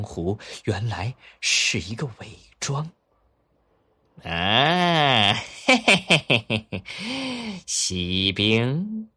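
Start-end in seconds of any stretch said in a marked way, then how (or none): 4.2-9.1 high cackling goblin, slow and loud; goblin cackle. Acted. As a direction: gloat like a sly little imp. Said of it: "妖精说".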